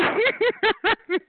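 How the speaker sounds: high-pitched cackle; taunting laugh. Real, not acted. Taunting the angry caller, high cackle. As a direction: let out a high peal of laughter.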